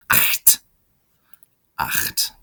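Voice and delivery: "Acht" said unvoiced with a throaty ch; teacher modelling "Acht"; whispered model word. Shown as a demonstration. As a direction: breathe out "Acht" with a rasping ch. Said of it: "The C H sound in acht".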